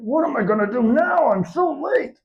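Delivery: high, whining fretful Santa; frustrated Santa. Acted. Santa fretting over the broken sleigh, high frustrated wail. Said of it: "He was so frustrated".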